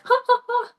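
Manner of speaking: high, fake "Ha, ha, ha"; a mocking, put-on high laugh; mock laughter. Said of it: "And people were like".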